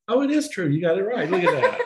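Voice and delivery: high, loud laughing cheer; excited. Real, not acted. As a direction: celebrate the right answer. Cheering her right answer.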